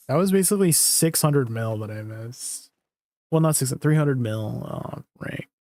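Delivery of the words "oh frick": breathy whispered frick; whispered curse. Real, not acted. Frustrated after missing the upgrade.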